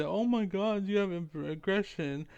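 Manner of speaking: high, hammed-up whiny complainer; mocking whiny gamer. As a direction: pitch it up, mock a crybaby gamer. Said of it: "and I say".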